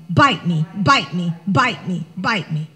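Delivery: low, mocking chant of bite me; what the mind says. Shown as a demonstration. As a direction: drop the voice low and repeat "Bite me" like a mantra. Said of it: "But what am I saying?".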